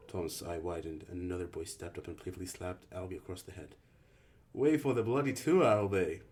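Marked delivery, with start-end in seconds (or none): 4.5-6.2 put-on higher and louder, British-sounding teen scolding; playful English rebuke of a friend; a hint of a British accent, raised and teasing; weird British accent. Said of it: "His voice was thick with a weird accent".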